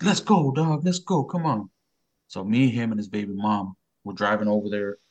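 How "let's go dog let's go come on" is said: high, eager rallying call; rally him to head out, pitched up; imitating a fired-up cousin; eager urging.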